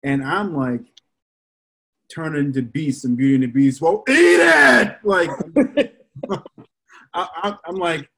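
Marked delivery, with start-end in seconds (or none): 3.8-5.0 loud, high, slow "eat it" shout; fed-up dad snapping at dinner; exasperated yell. Retold or imitated. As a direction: bark it at a kid who won't eat. Angry father yelling at son.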